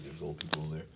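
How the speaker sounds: hushed so people nearby won't hear. Real, not acted. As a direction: keep it down near the neighbours. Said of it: "not trying to be creepy".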